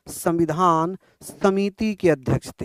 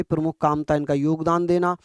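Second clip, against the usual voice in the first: normal talking voice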